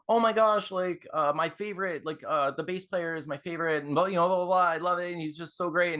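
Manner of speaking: high, rambling mock gush; gushing fangirl. Fan tells guitarist about her favorite bass player, gushing.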